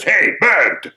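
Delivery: high, loud challenging crow. Acted. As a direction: call out as a nosy crow. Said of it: "Along comes the crow".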